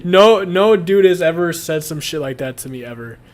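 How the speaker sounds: ordinary delivery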